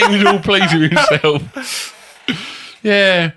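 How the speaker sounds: high laughing voice. Real, not acted. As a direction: laugh through it. Still laughing.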